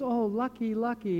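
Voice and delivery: high, falling gush of envy; envious villagers. Retold or imitated. Villagers envying the farmer when his son escapes the war, high and gushing. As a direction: coo it like jealous neighbours. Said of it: "And everybody goes".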